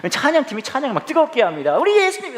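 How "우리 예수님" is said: much higher, excited worship leader cry. Retold or imitated. Preacher mimicking the worship leader at a rally, high and excited. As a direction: hype up the crowd like a worship leader.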